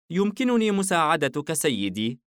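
put-on a small shop girl eager to help; young girl, helpful. الفتاة تعرض المساعدة. (صوت فتاة صغيرة عالٍ ولطيف)

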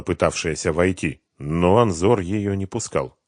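ordinary delivery, nothing marked

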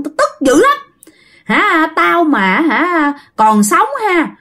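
high-pitched, resentful demon rant; scornful spirit voice, pitched well up; possessing demon. Acted. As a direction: spit out a grudge as an offended spirit. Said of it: "cái dông này mới trả lời á".

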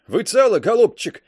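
loud, hoarse croak. A narrator's reading. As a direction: rasp it out, concussed. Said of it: "прохрипел тот".

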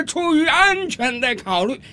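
high, drawn-out shout with a chuckle up front; friendly calling back. Acted. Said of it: "也很友好地冲这位旅游者喊道".

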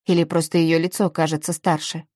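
usual speaking voice

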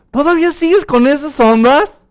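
high, loud mock-incredulous question. Lapsed friend scoffs at the preacher's faith, mocking. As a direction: jeer at a believer, imitating a scoffer. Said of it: "se burló de mí".

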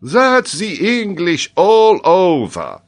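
high, loud, drawn-out outburst; Paganel's indignant exclamation, high and loud; exclaiming. A narrator's reading. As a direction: exclaim it slowly at full height. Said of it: "exclaimed Paganel".

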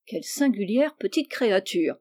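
ordinary delivery

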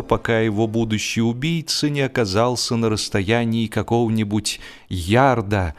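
ordinary delivery

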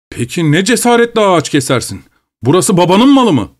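furious demand. Acted. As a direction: hurl the accusation. Overseer furious at tree cutting.

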